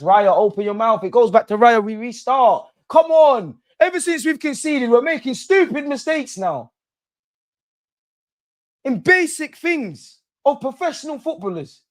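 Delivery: loud, high frustrated shouting; furious fan shouting at the team; angry yelling. Real, not acted. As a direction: yell at the keeper through the screen.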